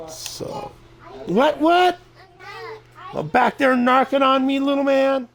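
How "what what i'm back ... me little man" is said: high mock voice. Mock voice addressing someone.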